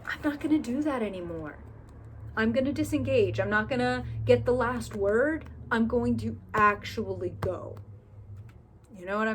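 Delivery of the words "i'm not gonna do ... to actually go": louder, firmly decided self-talk; louder resolved inner vow; firm resolve. Retold or imitated. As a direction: declare you're done with it, firmly. Voicing a resolved inner decision.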